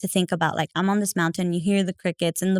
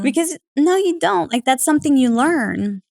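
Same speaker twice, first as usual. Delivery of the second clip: a higher, louder burst of protest; emphatic protest; 6 semitones higher, 3 dB louder than usual. Real, not acted. Protesting to the host that new moms must learn, high and emphatic. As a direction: push back hard, it's something you learn. Rebutting advice given to moms.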